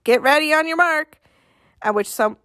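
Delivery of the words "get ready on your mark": called out. Acted. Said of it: "Bump called".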